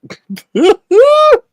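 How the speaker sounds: high cackling burst of laughter; just crack up; real high loud laugh. Real, not acted.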